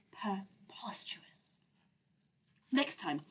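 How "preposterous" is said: whispered aside. Acted. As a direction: breathe the word out.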